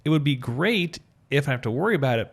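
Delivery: ordinary talk, nothing marked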